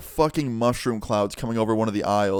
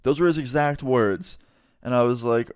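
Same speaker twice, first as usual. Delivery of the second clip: ordinary delivery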